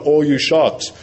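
ordinary delivery

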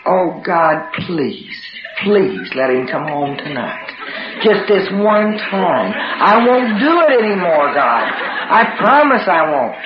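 slow, imploring bargain with God; pleading prayer. Retold or imitated. Acted desperate prayer for husband's return.

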